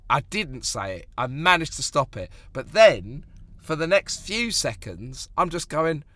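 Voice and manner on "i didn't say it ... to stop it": ordinary talk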